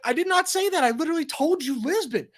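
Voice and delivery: high, exasperated insistence on every word; exasperated retort. Retold or imitated. Passenger tells confused driver the destination, exasperated and high.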